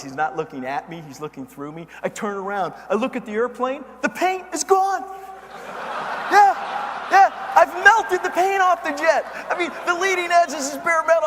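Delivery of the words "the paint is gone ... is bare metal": amazed loud reveal; astonished. Acted. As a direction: stretch out the shock. Discovering the melted paint.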